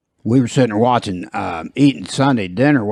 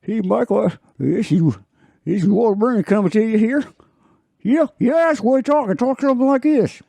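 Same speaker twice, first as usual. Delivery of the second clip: put-on much higher old-timer voice; reedy old-timer, pitched way up; Walter Brennan impression; 12 semitones higher than usual. Host imitates an old actor for a guest, high old-timer. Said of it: "he kinda talks like this".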